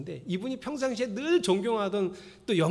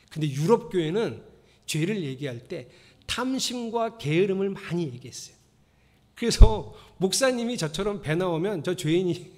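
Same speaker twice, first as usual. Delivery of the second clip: ordinary delivery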